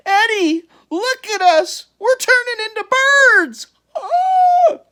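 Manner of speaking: high, loud panicked shriek. A narrator's reading. Al shrieks to Eddie, panicked and high. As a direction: shriek in horror. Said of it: "Al woke up and shrieked".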